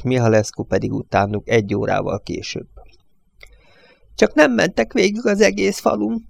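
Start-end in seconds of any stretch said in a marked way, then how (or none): 4.1-6.2 put-on high, bleating old man's voice; whiny, quavering old judge pitched high. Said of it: "mekegte a bíró".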